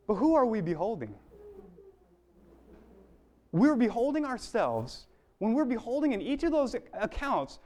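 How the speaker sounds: ordinary talk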